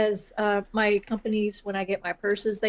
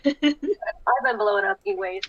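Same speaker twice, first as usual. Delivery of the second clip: higher and slow, laughing as she says it; laughing through it; 7 semitones higher, slower than usual. Real, not acted. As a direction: laugh the line out slowly. Repeats line while laughing.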